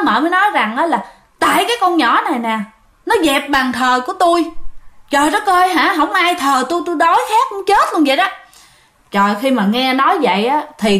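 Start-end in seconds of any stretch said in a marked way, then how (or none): 1.4-8.3 lightly voiced ghost complaining, higher; a hint of a hungry spirit whining; aggrieved spirit. Possessing spirit complaining its altar was removed, higher.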